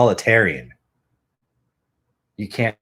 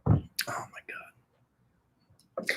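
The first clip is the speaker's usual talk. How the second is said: whisper; 10 dB quieter, much slower than usual. Real, not acted. Exasperated under breath.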